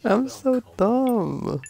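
high slow whine at own mistake; whiny drawn-out lament. Real, not acted. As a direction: wail it out in mock despair. Self-scolding after a mistake.

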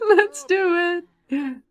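high, slow, giggling; giddy laughing. Real, not acted. Gamer about to propose, giddy laughing. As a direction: stretch it out through laughter.

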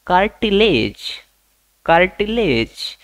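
कार्टिलेज said slowly as KAAR-ti-lej; slow model word. Shown as a demonstration. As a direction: draw out कार्टिलेज for students to copy. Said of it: "उपास्थि को English में क्या बोलेंगे?". (कार्टिलेज धीरे और स्पष्ट बोलना)

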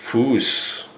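Fuß with a long oo and sharp s; Fuß as a clear German command. Shown as a demonstration. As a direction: say Fuß like foos. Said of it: "Heel in German is".

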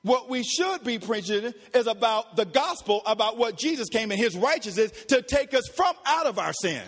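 usual speaking voice

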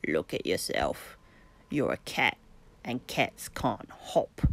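croaking frog. A narrator's reading. Frogs croaking at the kitten by the stream, low and croaky. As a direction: croak it out like a big pond frog. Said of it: "croaked the frogs".

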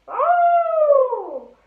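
high, loud animal howl; savage howl. Pretend. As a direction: let a beast howl into the night air. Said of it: "I don't think that was savage enough".

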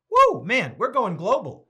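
loud, high woo, then happy bragging; excited cheer. Real, not acted. Pastor cheering global viewers with a loud, high woo. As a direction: cheer like the room just went worldwide.

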